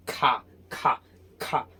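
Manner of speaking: "खा" as short aspirated bursts; British pronunciation. Shown as a demonstration. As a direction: puff air after the k in "खा". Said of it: "British accent सीखते हैं". (British ढंग का "खा", हवा के साथ)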